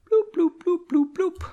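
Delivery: high-pitched censor bloops; cartoon censor beeps; bloop censor noises. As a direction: bleep it out with silly high bloops. Censoring a word with sound.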